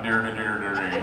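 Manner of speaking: low, mumbled Southern gibberish; mock Southern accent. Shown as a demonstration. Actor mocks how he still sounds after coaching, Southern gibberish. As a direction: lightly parody your own Southern twang. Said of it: "So I got with a dialect coach".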